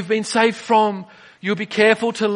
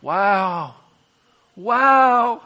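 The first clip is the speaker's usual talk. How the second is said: marvel, each wow bigger; growing amazement, acted out; awestruck; much slower than usual. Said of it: "we will just be".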